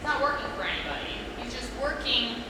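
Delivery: normal talking voice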